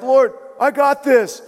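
loud, high, cocky eagerness; Peter. Retold or imitated. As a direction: jump in like an overeager defender. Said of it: "Peter's like".

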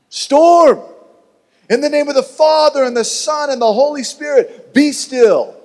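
high, very loud commanding rebuke; a holy command to the wind; super holy rebuke. Pretend. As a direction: thunder it over the storm. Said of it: "Was it super holy".

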